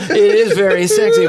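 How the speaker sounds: laughing. Real, not acted. Joking about his voice.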